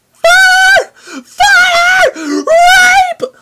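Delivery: shrieking high yells; random yell. Retold or imitated. Guy yelling randomly at friends in the mall, shrieking high. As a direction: scream it out of nowhere, way up high. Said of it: "I'd yell something random".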